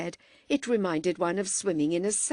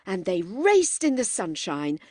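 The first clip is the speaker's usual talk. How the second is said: ordinary delivery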